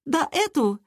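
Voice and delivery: put-on high, squeamish girl's scorn; disgusted. A narrator's reading. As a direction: dismiss her with high-voiced disgust. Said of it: "Лера брезгливо посмотрела". (брезгливо, тонким голосом)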